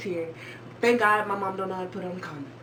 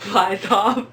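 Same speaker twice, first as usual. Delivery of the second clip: laughing; 2 semitones higher, 6 dB louder than usual. Real, not acted. Laughing at the condom story.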